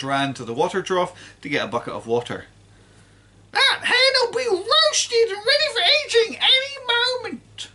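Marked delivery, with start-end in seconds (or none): 3.5-7.7 put-on high, loud screeching old woman; cranky grandma yelling from the roof; shouting grandma. A narrator's reading. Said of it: "Grandma shouted".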